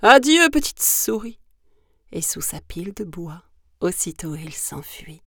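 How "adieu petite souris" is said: high, loud goodbye in fright; frightened snake's raised cry; panicked farewell. Acted. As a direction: call out as you flee. Terrified snake flees.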